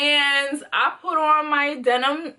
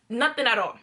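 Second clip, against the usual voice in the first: normal talking voice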